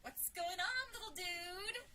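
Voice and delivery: put-on high-pitched talking to a little boy; high, playful hello to a child; chummy greeting to a kid. A narrator's reading. Greeting a friend's little brother.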